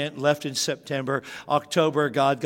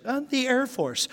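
high, meek answer; sheepish reply; 11 semitones higher than usual. Retold or imitated. Son recalls telling dad he picked the Air Force, high and sheepish. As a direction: pitch up and shrink. Own younger self sheepish.

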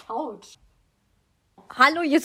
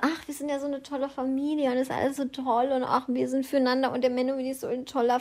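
mocking parody; 7 dB quieter than usual. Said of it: "Also immer".

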